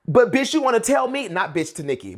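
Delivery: usual speaking voice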